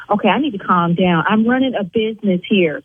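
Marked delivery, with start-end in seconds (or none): none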